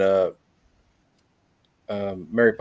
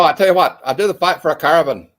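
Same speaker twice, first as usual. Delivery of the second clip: put-on louder, higher mumbled Irish traveller accent; Brad Pitt in Snatch; 5 semitones higher, 6 dB louder than usual. Showing friends his Irish traveller accent from Snatch, louder and higher. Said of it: "talk exactly like Brad Pitt in Snatch".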